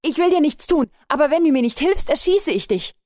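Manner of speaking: viel höher und lauter, Stimme überschlägt sich; überschlagene Stimme. A narrator's reading. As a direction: sich vor Erregung überschlagen. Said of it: "mit überschlagener Stimme". (überschlagende Stimme, drohend)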